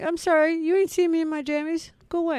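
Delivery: squeaky put-on voice, shooing them off; high mock voice. Mock reply to late boss call.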